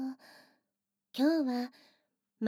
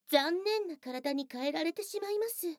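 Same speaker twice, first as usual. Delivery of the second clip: ordinary talk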